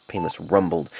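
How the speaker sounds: plain reading voice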